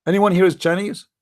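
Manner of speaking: usual speaking voice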